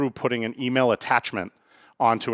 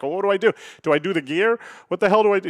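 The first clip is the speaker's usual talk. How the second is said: higher-pitched mock panic; freaking out; 6 semitones higher, faster than usual. Daughter learning stick shift from dad, mildly freaking out. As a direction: play a flustered learner driver, lightly panicked. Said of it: "[name]'s freaking out now".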